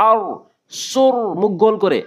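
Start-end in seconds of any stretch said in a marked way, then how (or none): 0.1-0.2 আর said thick with rounded lips; full heavy r. Shown as a demonstration. Teacher demonstrating the full rounded আর. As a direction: round out আর fully. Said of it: "পুর করে".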